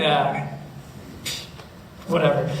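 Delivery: low shrugging brush-off; dismissive. Retold or imitated. Mark dismisses his friend's worry, low and shrugging. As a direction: drop the voice and shrug it off. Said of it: "And I'm like".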